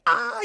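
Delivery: imitating a loud scream; short mock scream. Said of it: "full-blown screaming".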